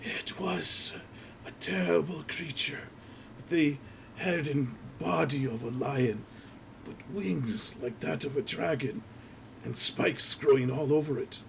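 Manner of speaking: high, soft rasp of a slain man; raspy voice from a corpse. Acted. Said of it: "you hear this kind of raspy voice says".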